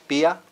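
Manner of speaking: "pia" in two syllables, pee-ah; "pia" with no diphthong; separate vowels. Shown as a demonstration. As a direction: keep the I apart from the A in "pia". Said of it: "the I is pronounced separately from the A".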